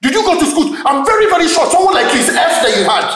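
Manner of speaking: high, heated shouting; insulting tirade. Acted. Husband questioning nurse's schooling, high angry tirade. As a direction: berate her, tripping over words.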